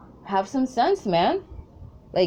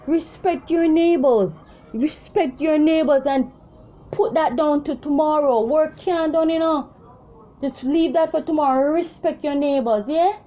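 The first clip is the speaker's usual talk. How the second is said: raised, higher scolding of the neighbors; retold telling-off; 7 semitones higher than usual. Vlogger retelling how she scolded the noisy neighbors, high and raised. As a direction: call it across the yard, high and insistent.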